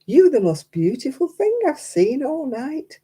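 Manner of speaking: put-on slow, drawn-out drunk flattery. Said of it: "He said".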